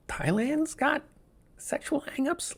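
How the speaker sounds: high-pitched incredulous question; mock surprise. Host recalling a reaction to Thailand's news, high-pitched surprise. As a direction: retell it as squeaky disbelief. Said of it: "especially surprised by Thailand".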